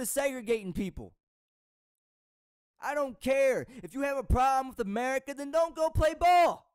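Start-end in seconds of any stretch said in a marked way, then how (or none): 2.8-6.7 real anger building to a loud shout on play ball; fed up and yelling by the end; frustrated shout. Real, not acted. Vlogger fed up with complaining athletes, rising angry shout. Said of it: "I'm sorry that I'm upset and I'm frustrated".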